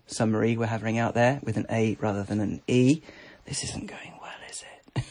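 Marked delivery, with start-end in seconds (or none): 3.5-5.0 whispered aside. Real, not acted. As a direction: whisper it under your breath. Aside to herself, unvoiced.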